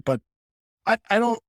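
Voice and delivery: ordinary talk, nothing marked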